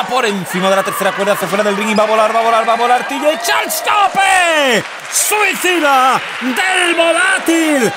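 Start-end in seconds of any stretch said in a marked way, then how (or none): 3.1-7.9 slow, high excited call; excited shout. Real, not acted. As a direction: drag out the big dive. Dive called out. (grito emocionado)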